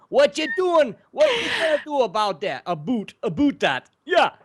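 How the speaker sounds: high, loud hammed-up Canadian voice; exaggerated Canadian accent, "a boot"; Canadian accent impression. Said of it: "Saskatchewan is like".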